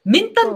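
"メン探偵" as a playful stumble; flubbed word. Retold or imitated. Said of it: "って言ってた".

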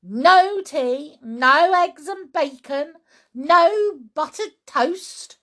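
put-on emphatic, complaining old grandma; grumbling grandma.

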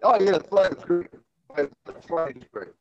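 ordinary delivery

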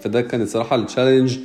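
normal talking voice

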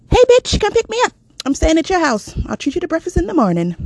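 loud, high, bossy friend voice; pushy friend. Retold or imitated. Friend on the phone barking orders in a high, loud voice. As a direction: boss her around like a brash best friend. Friend D calls demanding a ride.

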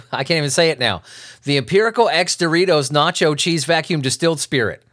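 ordinary talk

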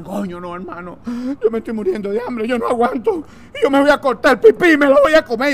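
desperate castaway. Acted.

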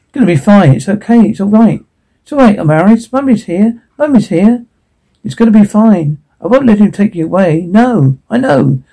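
put-on mother hushes her baby, keeping her own fear down; soothing mother.

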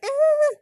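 sudden very high mock squeal; a sharp squeal like schoolgirls squaring off; a high squeal imitating a high school girl fight; girl-fight yelp. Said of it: "how girls are like".

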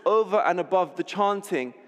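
ordinary talk, nothing marked

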